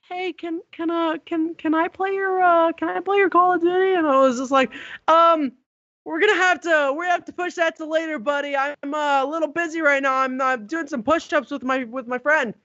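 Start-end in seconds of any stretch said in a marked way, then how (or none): 0.1-3.9 put-on high, hesitant little-boy voice; Ryan. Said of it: "he was just like". 5.1-12.5 higher-pitched, haltingly made-up excuse; awkward stalling. Retold or imitated.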